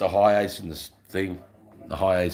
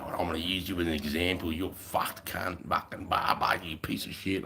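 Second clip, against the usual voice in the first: quiet menace; 6 dB quieter than usual. Retold or imitated. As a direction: threaten in a soft menacing voice. RSM threatening him.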